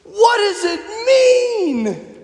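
high, slow, sobbing cry of awe; crying hippie. Retold or imitated. Hippie overwhelmed by the double rainbow, high sobbing wail. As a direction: wail the question through tears. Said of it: "he's like".

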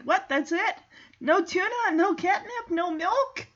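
mock indignant, high questioning voice; miffed cat. Cat complains about her reward, mock indignation.

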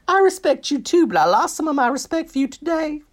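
hammed-up, very high-pitched mimicry; awful Heidi impression. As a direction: do a bad, squeaky-high impression. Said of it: "That is an awful impression".

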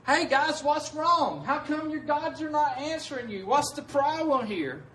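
high, loud mock concern, teasing the prophets; mocking taunt. Elijah taunting the false prophets, high mocking jeer. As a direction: jeer at them like it's a joke. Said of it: "making this a joke and being like".

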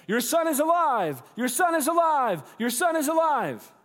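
high, very loud jubilant shout; excited shouting. Acted. Servants shouting good news to their boss, excited shouting. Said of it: "they begin shouting".

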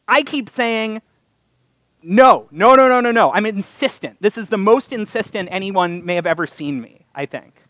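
ordinary talk

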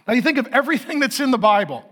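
normal talking voice

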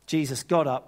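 ordinary talk

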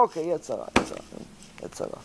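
mock muttered excuse. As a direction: let the excuse fade to a breath. Mocking repeated excuse, fading.

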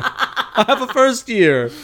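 high, drawn-out mock pleading; milk the fake plea for laughs; hammed-up desperate plea. Repeats the parody plea, laughing.

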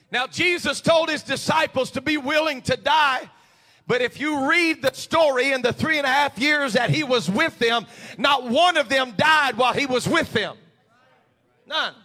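loud, high, building shout of a preacher; preaching at full force. Acted. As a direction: build the sermon to a roaring climax.

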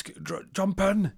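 high, slow, stammered order; high slow urgent. Retold or imitated. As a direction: hurry him inside. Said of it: "He goes".

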